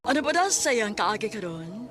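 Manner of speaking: usual speaking voice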